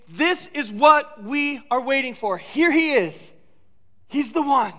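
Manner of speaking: loud, building cry of joy; excited proclamation. Acted. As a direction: announce it like the long wait is over. Disciples' excitement, acted out.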